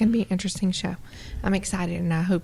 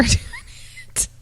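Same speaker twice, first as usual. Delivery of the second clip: ordinary delivery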